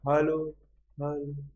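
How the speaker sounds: two-part demo echo, fading on the second; imitated single echo. Shown as a demonstration. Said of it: "બે વખત".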